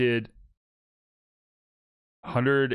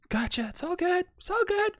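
squeaky falsetto, far above usual voice; high-pitched reassurance. Pretend. Teammate waves off a mix-up over grass, in playful falsetto.

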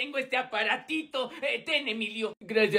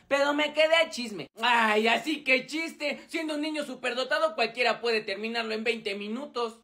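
ordinary delivery

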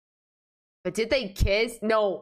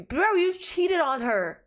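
incredulous outburst; 7 semitones higher, slower than usual. Real, not acted. Outraged at cheater character.

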